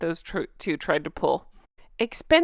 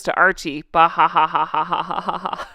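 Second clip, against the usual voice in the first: evil gloating cackle at the end; gloat, then cackle like a villain; gloating villain laugh; 4 semitones lower than usual. Retold or imitated. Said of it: "imagined Harry gloating".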